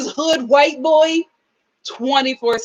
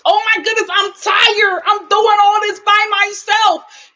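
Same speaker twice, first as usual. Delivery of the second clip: high, whiny imitation of an overwhelmed mom; mock her self-pity, pitched up; whiny mock complaint; 7 semitones higher than usual. Mocking Sarah's complaint.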